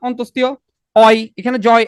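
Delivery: normal talking voice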